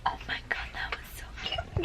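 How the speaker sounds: soft whispered gush over the cat; hushed, delighted whisper. Real, not acted. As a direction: whisper it so the cat isn't startled.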